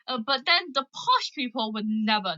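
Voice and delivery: usual speaking voice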